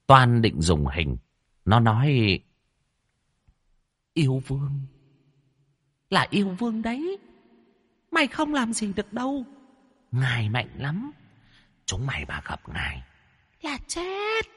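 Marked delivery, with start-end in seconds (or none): 4.1-14.5 high, soft, ghostly demon voice; eerie high-pitched menace from a spirit; haughty female demon. Acted. Female demon warns the exorcist about her master, eerie menace.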